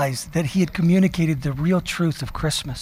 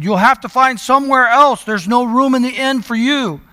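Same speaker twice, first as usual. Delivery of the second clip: louder, higher gruff innkeeper turning them away; rough innkeeper; 5 semitones higher, 3 dB louder than usual. Retold or imitated. Boy playing the innkeeper refusing Mary and Joseph, rough and loud. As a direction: bark him off the doorstep, lightly hammed. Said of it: "playing the innkeeper in his rough voice".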